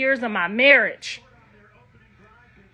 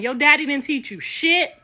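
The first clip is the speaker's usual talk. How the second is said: ordinary delivery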